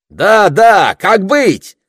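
high, loud chiming in; animated. A narrator's reading. Miha's enviers joining in from several sides, animated. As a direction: eagerly back up the heckler. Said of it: "оживились завистники Михи".

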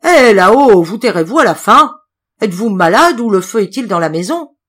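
gruff, very loud shout; rough voice. Acted. Innkeeper shouting up at the noisy guest, gruff and loud. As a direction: bellow up the staircase in anger. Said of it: "la voix rude de l'autre".

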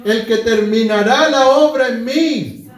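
loud, slow, rising sermon climax; impassioned preaching. Real, not acted. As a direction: proclaim each phrase at full force. Preaches at full force.

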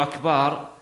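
أكبر said as akbaar; long final syllable in أكبر, like أكبار; stretched bā. Shown as a demonstration. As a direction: draw out the vowel after the b in أكبر. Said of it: "الباء".

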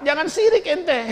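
mock angry hypocrite. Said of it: "dia marah".

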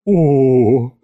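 long, swooning "oh"; drawn-out gasp. A narrator's reading. Butler seeing the tsar's beard, drawn-out awe. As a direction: stretch it out in admiration. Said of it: "протянул дворецкий".

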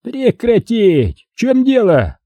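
high-pitched, drawn-out bellow; high, commanding outcry; yelled command. A narrator's reading. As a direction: yell out to halt a fight, high. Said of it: "заорал Гусейнов".

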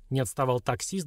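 plain reading voice

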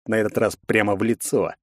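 plain reading voice

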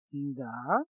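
low, soft, sultry "да"; languid. Acted. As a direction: breathe it low and seductive. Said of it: "томным голосом проговорил Кайл".

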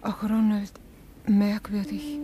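ordinary delivery

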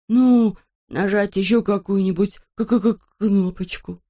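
stuttering, hesitant suggestion; timid, stuck-on-a-consonant stutter; nervous stammer. Acted. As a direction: stumble repeatedly on the hard k.

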